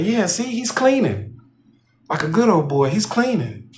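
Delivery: high-pitched mocking girl's taunt. Young manager showing her dad on her phone, high taunting. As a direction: tease like a bratty kid, pitched high. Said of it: "the whole taunting".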